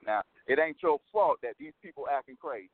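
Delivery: ordinary delivery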